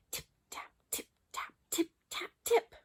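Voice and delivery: whispered, fading-in tip-tap; soft tapping noises. A narrator's reading. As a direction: tap it out in a hush, like mice. Mysterious little noises.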